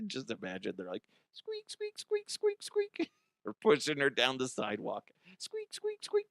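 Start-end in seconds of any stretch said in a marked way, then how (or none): 1.3-3.1 high squeaking chair wheels; tiny high mock squeaks; squeaky chair noise. Imitating wheeled office chair. 5.4-6.2 high squeaking chair wheels; tiny high mock squeaks; squeaky chair noise. Imitating wheeled office chair.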